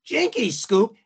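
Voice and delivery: high-pitched Shaggy cartoon voice; Casey Kasem. Actor doing a level check as Shaggy, high cartoon voice. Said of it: "he starts doing the voice".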